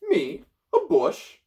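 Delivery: loud, high outrage; appalled. Acted. Merlin appalled at being called a bush, loud. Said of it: "exclaims, appalled".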